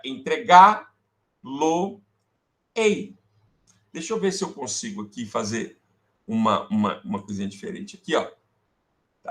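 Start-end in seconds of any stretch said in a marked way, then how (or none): 0.0-3.2 e fica entregar-lo-ei stretched out slowly; very slow demo. Shown as a demonstration. Teacher showing students the new form, very slow. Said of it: "mas cai o R".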